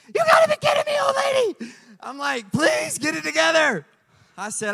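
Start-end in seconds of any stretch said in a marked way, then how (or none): 0.1-1.7 very high, much louder squeal; exasperated shriek. Real, not acted. Preacher reacting to the old lady, exasperated high shriek. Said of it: "own outburst". 2.5-3.9 higher, louder, pleading; exasperated plea. Retold or imitated. Husband to the lab lady, exasperated high plea.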